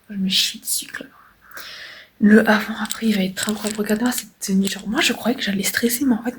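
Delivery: soft nervous whisper. Real, not acted. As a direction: whisper so the nurses won't hear. Whispering before nurses arrive.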